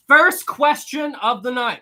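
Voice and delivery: game show announcer, slow and emphatic; drawn-out host announcement. Acted. Host opening the night's first trivia question to chat, announcer voice. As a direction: stretch it out like a quiz host.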